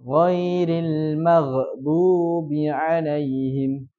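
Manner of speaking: Ghairil maghdubi alaihim recited slowly, l flowing; recitation demo. Shown as a demonstration. Teacher recites the verse slowly for students to copy. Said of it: "Jadi gimana bacanya".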